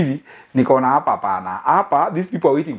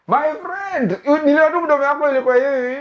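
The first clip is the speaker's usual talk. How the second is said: mock crying; 10 semitones higher than usual. Mock weeping over jealous neighbor.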